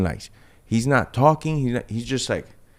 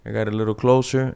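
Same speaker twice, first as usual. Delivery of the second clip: ordinary delivery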